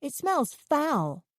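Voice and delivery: nose turned up. A narrator's reading. Miranda to Curtis, sniffy and disgusted. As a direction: say it like the smell offends you. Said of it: "turning her nose up".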